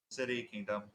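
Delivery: ordinary talk, nothing marked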